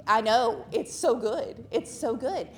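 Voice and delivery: loud, eager burst of delight; excited gush. Real, not acted. Speaker tells the church about breakfast, gushing loudly. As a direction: rave about it to the crowd.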